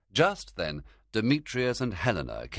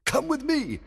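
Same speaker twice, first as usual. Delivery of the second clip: commanding; 5 dB louder than usual. Acted. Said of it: "Oberon ordered".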